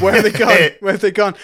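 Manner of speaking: high, loud imitation of confusion; mock bewilderment. Game master imitating Ark left behind, mock bewildered. GM echoes the bewildered abandoned character.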